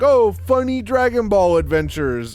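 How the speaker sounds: high, loud mock-jolly exclamation; breezy, upbeat series-promo cheer; carefree cheer. Mimicking the series' carefree early tone.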